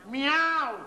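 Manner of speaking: loud, high meow; cat meow. Acted. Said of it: "No maules". (maullido de gato)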